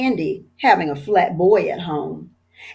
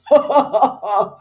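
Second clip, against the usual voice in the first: hearty chuckle; 3 semitones higher than usual. A narrator's reading. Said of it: "Chief Abbott laughed".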